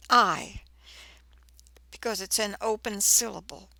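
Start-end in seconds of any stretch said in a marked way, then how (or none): 0.1-0.6 I said alone, long and louder; teacher isolating the long I in I; long I sound. Shown as a demonstration. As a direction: sound out I as a full long vowel. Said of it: "it's going to show us the long I sound".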